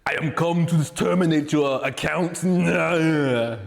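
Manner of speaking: loud comic impression with a drawn-out no; Terminator. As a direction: do a knock-off movie cyborg with a thick accent. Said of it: "non-copyright infringement Terminator".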